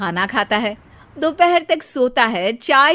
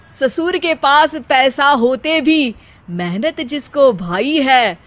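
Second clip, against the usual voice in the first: poetic recital; 4 dB louder than usual. Acted. Vicky recites couplet for father-in-law.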